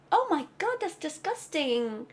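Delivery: recoil, drawing the last word out; slow, exaggerated revulsion; disgusted. Acts out revulsion.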